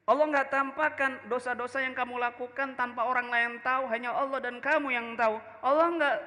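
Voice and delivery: tempting devil voice. Acted. The devil's whisper tempting the scholar.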